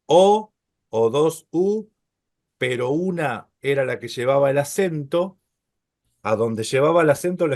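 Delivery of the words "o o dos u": slow demo. Shown as a demonstration. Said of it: "la palabra tenía dos".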